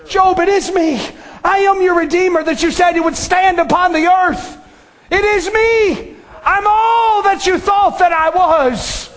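shouting testimony from the pulpit; fervent preaching. Acted. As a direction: preach it out with full fervor. Preacher voicing Jesus.

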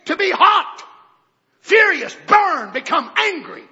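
loud, high, building cry; fiery shout. Real, not acted. Preacher shouting the meaning of displeased. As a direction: preach the anger out. Preacher defines anger fervently.

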